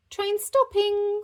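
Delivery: very high loud sing-song call; train conductor call. Acted. Bingo driving the toy train, conductor call. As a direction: announce it like a conductor. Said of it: "said Bingo".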